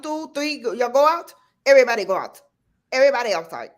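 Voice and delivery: put-on high, loud Caribbean grandma ordering kids out; Caribbean grandmother calling the cousins; Caribbean grandmother accent. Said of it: "your accent is impeccable".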